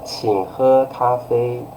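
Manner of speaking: slow, drawn-out courteous invitation; polite request. Shown as a demonstration. Teacher showing the second tone, polite. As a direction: ask politely, taking your time. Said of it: "polite way".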